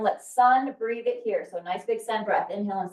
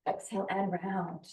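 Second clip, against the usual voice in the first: soft exhale cue; 3 semitones lower, 9 dB quieter than usual. Shown as a demonstration. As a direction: exhale cue, much softer and falling.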